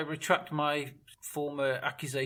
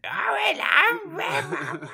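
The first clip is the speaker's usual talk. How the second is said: very high, loud, drawn-out mock Cockney; mock the worm's Cockney with huge exaggeration; hammed-up Cockney caricature, stretched out; over-accentuated Cockney; 9 dB louder, much slower than usual. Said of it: "It's, like, accentuated to fuck".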